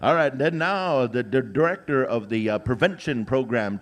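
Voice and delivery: usual speaking voice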